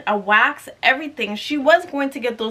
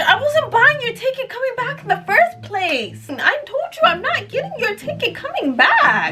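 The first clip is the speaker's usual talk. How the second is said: high, sassy mock rant, half laughing; high-pitched sassy inner comeback with a laugh; exasperated inner retort; 11 semitones higher than usual. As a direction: snap back at her in your head, incredulous. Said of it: "all I could think of is".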